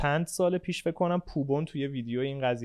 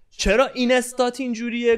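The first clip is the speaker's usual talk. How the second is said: higher, louder sneering question; mocking gym guy; 9 semitones higher, 5 dB louder than usual. Imitating a boy at the gym lunch mocking him, higher and sneering. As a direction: mimic a guy teasing his Instagram. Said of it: "یه اونجوری کرد".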